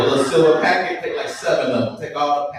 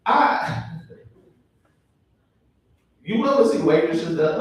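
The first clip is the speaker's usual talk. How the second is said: ordinary talk, nothing marked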